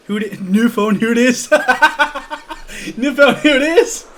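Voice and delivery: loud, high, cracking up; laughing. Real, not acted. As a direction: shout the joke through laughter. Cracking up at phone joke.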